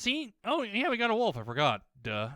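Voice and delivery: ordinary talk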